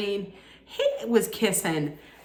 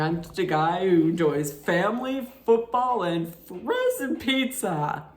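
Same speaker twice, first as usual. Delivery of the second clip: a lightly voiced, mocking take on a bland bachelor; mock Zach. Mocking the bachelor's party intro, lightly voiced cheesy impression. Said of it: "by saying".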